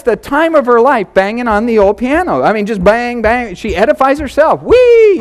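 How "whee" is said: put-on high, loud childlike whee; gleeful squeal. Father mimics daughter's delight at the piano, high loud squeal. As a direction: squeal like a delighted little girl.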